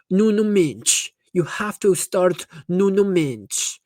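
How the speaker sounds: imitating a hammed-up Portuguese announcer accent, slow; mock Portuguese TikToker drawling the name in a thick accent. Imitating a Portuguese TikTok guy announcing names, thick accent. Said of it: "like, the most thickest accent".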